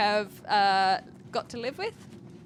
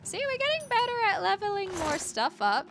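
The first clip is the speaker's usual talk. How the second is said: bright high voice; 10 semitones higher than usual. Real, not acted. Cheerful game remark.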